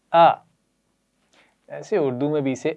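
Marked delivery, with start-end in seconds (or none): 0.1-0.3 a clean short glottal "آ" from the throat; glottal a sound. Shown as a demonstration. Teacher modelling "آ" for the children, crisp glottal a. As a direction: say "آ" as a crisp glottal stop plus a. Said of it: "سب پڑھیے مل کر".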